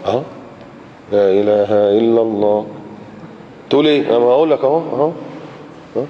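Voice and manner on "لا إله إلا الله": lips held still. Shown as a demonstration. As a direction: say it with unmoving lips, as if not speaking. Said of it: "أنا اتكلمت".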